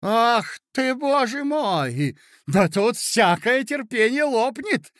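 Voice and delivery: loud, high exasperated cry. A narrator's reading. Marya Aleksandrovna crying out to the guests, loud and high. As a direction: wring out the despair, loud and high. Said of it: "кричала Марья Александровна".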